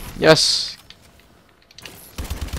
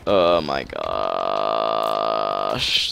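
long drawn-out groan; 14 semitones lower, 4 dB quieter, much slower than usual. Real, not acted. Stunned by gameplay.

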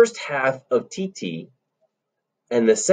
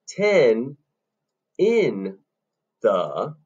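TEN, IN, THE slowly, a pause after each; separated syllables; much slower than usual. Shown as a demonstration. Teacher counting three syllables, slow and separated. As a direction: spell out TEN IN THE one beat at a time. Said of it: "leaves us three syllables on this beat".

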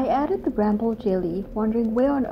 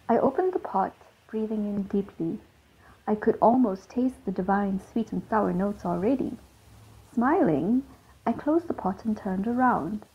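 ordinary delivery, nothing marked

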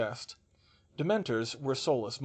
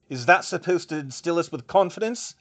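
raised, louder irritated challenge; snapped; 5 semitones higher, 7 dB louder than usual. A narrator's reading. Delegate challenging an official's promise, snapped. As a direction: snap it as a challenge. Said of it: "he snapped".

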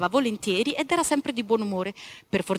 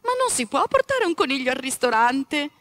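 mother's objection; 5 semitones higher, 5 dB louder than usual. Acted. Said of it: "replicò la madre".

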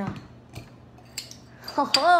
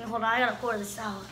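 ordinary delivery

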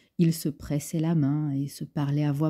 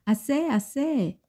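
high, slowed exasperated cry of enough; scolding cry; 10 semitones higher than usual. Acted. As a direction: stop the child splashing with a high, drawn-out cry. Maid scolding splashing child.

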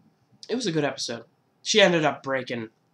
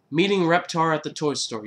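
ordinary talk

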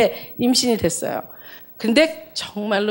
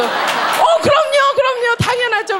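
overjoyed; 13 semitones higher, slower than usual. Retold or imitated. Overjoyed reply to the doctor.

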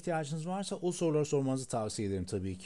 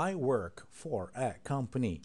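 very slow answer; slower than usual. Shown as a demonstration. Teacher slowing the answer down for students. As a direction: spell out each word slowly. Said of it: "Yavaşlatıyorum tekrardan".

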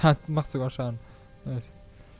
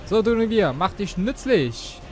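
loud, slow command to the dragon; commanding call; 6 semitones higher, 5 dB louder than usual. Real, not acted. Summoning his dragon.

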